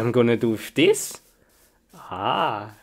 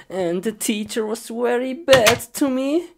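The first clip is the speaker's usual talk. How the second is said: higher, plaintive complaint climbing upward; whining complaint; 8 semitones higher than usual. Acted. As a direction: complain like a hard-done-by pupil. Whining about school in a skit.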